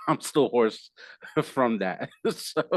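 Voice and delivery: a real burst of high laughter; laughing. Real, not acted. Laughing at his own hoarseness.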